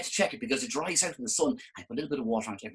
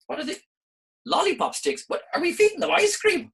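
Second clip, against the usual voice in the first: mock surprise; 13 semitones higher, 5 dB louder than usual. Mock confusion.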